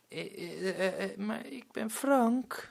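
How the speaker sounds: put-on high, soft, slow hesitant child voice; a scared boy stuttering out his name; stammering little boy. Boy on the roof answers the angry pirate, timid stammer. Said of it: "zegt het jongetje".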